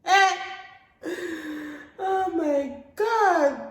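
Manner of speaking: high, stretched-out cry of shock. Real, not acted. Girl told she's left abroad, shocked high cry. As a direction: gasp it out in disbelief.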